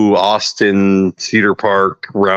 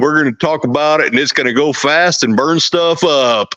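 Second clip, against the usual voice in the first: put-on Texas accent; 3 semitones higher than usual. Texan showing off new motors to viewers, hammed-up Texas accent. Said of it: "I never got that accent".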